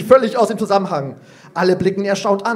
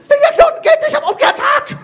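much higher, loud triumphant squeak; proud squeaky girl; 6 dB louder than usual. Acted. Youngest girl triumphs in the playground squabble, high squeaky shout. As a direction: squeal it like a proud little kid. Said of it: "piepst Ida stolz".

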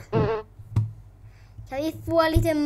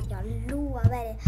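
softer, keeping it down; quieter; 5 semitones lower, 7 dB quieter than usual. Real, not acted. Gamer promising his followers, softer. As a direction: promise it softly. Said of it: "Nu kan jag prata lite tystare".

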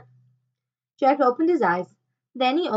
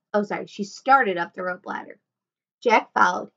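ordinary delivery

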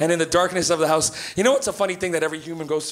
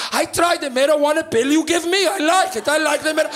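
high-pitched, accented imitation of Mom; mother confessing; 8 semitones higher than usual. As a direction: blurt it out like an enthused mother. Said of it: "she goes".

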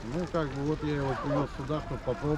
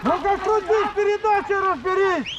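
shouting; 10 dB louder, slower than usual. Real, not acted. Coach yelling at racer.

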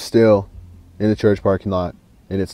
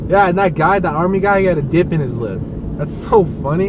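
excited; 6 semitones higher, 6 dB louder than usual. Real, not acted. Excited retelling of car crash.

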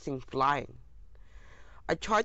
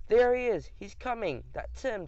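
cheering crowd; 7 semitones higher than usual. A narrator's reading. Crowd greeting someone at the stage, cheering. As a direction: voice an excited crowd, pitched up. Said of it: "they cheered".

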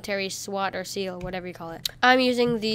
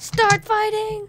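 announcer call; 10 semitones higher, much slower than usual. Acted. Countdown ends, match starts.

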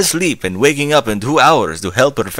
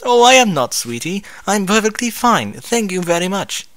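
high posh lady voice; 10 semitones higher than usual. Acted. Rarity voiced in read story.

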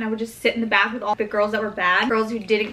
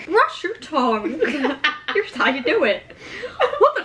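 dental commercial voice; 4 semitones higher than usual. Pretend. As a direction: sell it like a toothpaste ad. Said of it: "This is a dental commercial".

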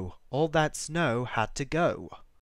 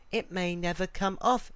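higher voice for an anxious little sister; worried girl; 5 semitones higher than usual. A narrator's reading. Sally frets over the spot on mother's dress, worried. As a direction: lift the pitch, a worried child.

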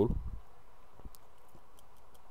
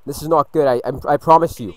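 alarmed outburst; 5 semitones higher, 10 dB louder than usual. Real, not acted. Alarm as the match turns.

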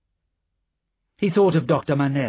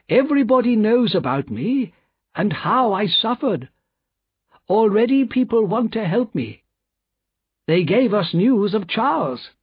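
higher-pitched, earnest, insistent doctor; confident doctor; 9 semitones higher than usual. Acted. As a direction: assure them with raised, emphatic voice. Doctor reassures his friend.

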